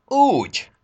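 high, loud indignant "oh really"; sharply offended exclamation. A narrator's reading. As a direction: fire back in outrage. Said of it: "méltatlankodott Mikulás".

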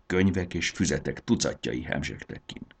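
ordinary delivery, nothing marked

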